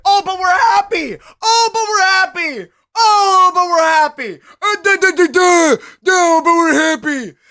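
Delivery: hammed-up tearful wail, loud; mock sob. Streamer fake-sobs to chat about being happy, hammed up. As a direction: cry out a fake lament.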